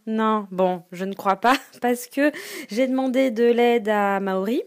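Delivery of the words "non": ordinary talk, nothing marked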